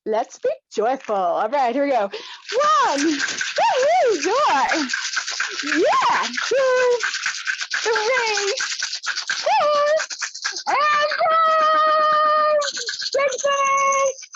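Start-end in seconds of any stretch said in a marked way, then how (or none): 2.1-12.6 high, loud joyful count; joyful cheering. Shown as a demonstration. Leader demos joy for the group, loud and high. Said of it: "we are going to be joyful". 12.6-14.3 high excited count; joyful cheer. Shown as a demonstration. Leader stretches the joyful count, high. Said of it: "joy demo continues".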